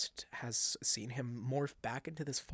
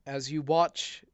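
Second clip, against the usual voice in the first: ordinary talk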